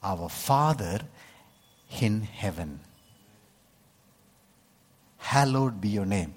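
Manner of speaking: a gentle, hushed model prayer. Shown as a demonstration. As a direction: pray it softly and reverently, like a demonstration. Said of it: "you pray like this".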